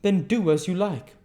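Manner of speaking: loud high outburst; hammed-up angry man; violent retort. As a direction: fling the line out violently. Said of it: "in his violent way".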